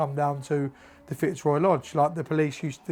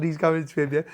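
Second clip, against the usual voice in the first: ordinary talk, nothing marked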